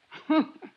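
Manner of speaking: a quick high amused laugh; short laugh. Acted. Landlady laughing at the insurance man. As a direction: laugh him off at the word.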